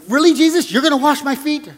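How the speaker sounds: incredulous objection. Retold or imitated. Preacher voicing Peter objecting to Jesus washing his feet, incredulous. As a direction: protest it, not ask it. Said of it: "He was asking it as an objection".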